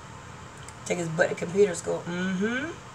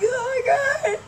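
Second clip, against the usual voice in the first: high, tearful cry of joy. Retold or imitated. Telling her neighbor about the picture, a high tearful cry. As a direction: cry it out, overwhelmed and happy. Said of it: "I was like".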